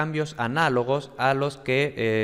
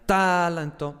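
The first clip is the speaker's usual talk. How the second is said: "talanto" as TAAA-lanto, first syllable stretched; exaggerated initial accent on "talanto"; stress on first syllable; 5 semitones higher, slower than usual. Shown as a demonstration. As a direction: hit and hold the TA of "talanto", rush off the rest. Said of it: "un acento tan grande en la primera sílaba".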